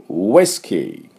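ウイスキー stretched out slowly with rounded lips; slow model whisky. Shown as a demonstration. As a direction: say ウイスキー slowly for students to copy. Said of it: "私の後について発音いきましょう". (ゆっくり手本のウイスキー)

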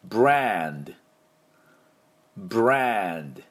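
ブランド said slowly and carefully as a model; slow ブランド for students to repeat; teacher's model ブランド, nasal n and a clipped d; model pronunciation. Shown as a demonstration. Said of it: "私の後で発音いきましょう".

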